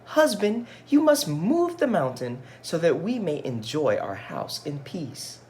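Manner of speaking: high, complaining wife voice; high-pitched fed-up wife; wife's voice. Acted. Wife voiced in read-aloud story.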